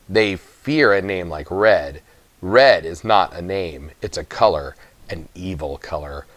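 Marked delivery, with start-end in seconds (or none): 5.1-6.3 low, much softer and darkly hushed; ominous hush. A narrator's reading. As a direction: let it sink, sinister and soft. Narrator broods on the name Red.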